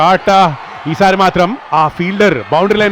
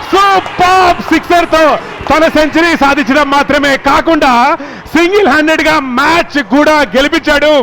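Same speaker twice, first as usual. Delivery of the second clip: excited cricket commentary; 6 semitones higher, slower than usual. Real, not acted. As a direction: roar the winning six. Match-winning six called live.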